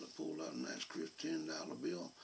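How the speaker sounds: usual speaking voice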